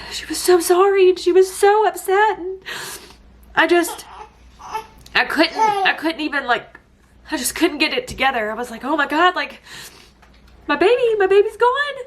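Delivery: high, breaking voice, sobbing through the words; barely holding it together, tears in every word; crying. Real, not acted. Still crying through the memory.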